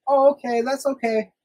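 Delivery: high, falsely accepting voice; imitated sweet mom. Mom pretends to believe son's friend, sweetly. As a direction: act easygoing just before exploding.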